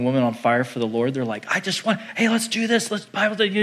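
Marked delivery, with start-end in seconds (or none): 1.5-3.6 high-pitched, tumbling, eager voice; imitated excitement. Young believer on fire for the Lord, imitated excitement. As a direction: play a keen young believer bursting to start. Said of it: "they're just excited".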